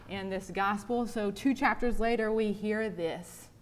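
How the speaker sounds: usual speaking voice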